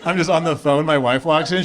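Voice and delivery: usual speaking voice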